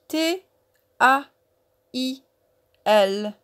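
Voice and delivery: each letter said separately with a pause; épeler lettre par lettre. Shown as a demonstration. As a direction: spell it out slowly for learners. Spelling taille letter by letter. (lettres bien détachées, une par une)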